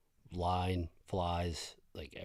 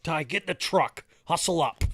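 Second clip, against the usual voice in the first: put-on higher, louder command to a kid; a father ordering his son into the truck; retold dad order; 11 semitones higher, 10 dB louder than usual.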